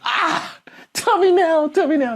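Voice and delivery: high squealing whimper, tell me now; hammed-up childish begging; mock pleading whine. [name] begging Richmond to reveal the offer, squealing whine.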